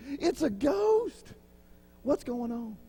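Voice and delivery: high, slow mock fright at a ghost; Peter frightened. Peter on the boat seeing a ghost, mock fright. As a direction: gasp out like startled Peter. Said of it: "I'm like Peter".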